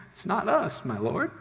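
high-pitched, mock-meek denial; nervous officers. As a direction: plead innocence to an angry king. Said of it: "knowing they're in trouble".

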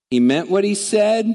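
ordinary talk, nothing marked

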